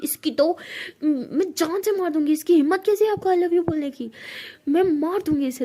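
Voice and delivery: retold rage at the rival; outraged girlfriend swearing revenge; furious threat. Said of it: "तो परी बहुत गुस्सा कर रही होती है".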